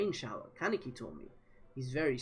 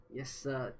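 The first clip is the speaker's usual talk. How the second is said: ordinary delivery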